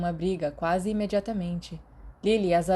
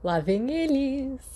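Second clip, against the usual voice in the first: excited shout; 7 semitones higher, slower than usual. Acted. Sirius bouncing, eager arrival.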